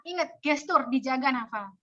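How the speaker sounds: normal talking voice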